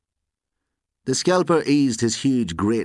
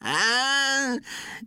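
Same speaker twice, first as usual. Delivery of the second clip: high-pitched mocking cackle; high, acted taunting laughter; 3 dB quieter than usual. A narrator's reading. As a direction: burst out in a jeering laugh at his back. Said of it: "burst into a taunting laugh".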